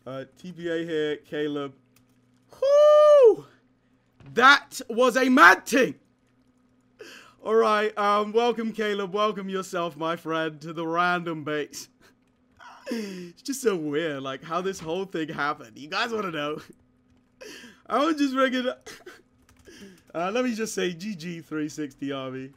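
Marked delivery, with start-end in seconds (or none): 2.6-3.5 very high whooping cheer. Real, not acted. Gamer whooping to welcome Caleb. 17.3-18.9 laughing. Real, not acted.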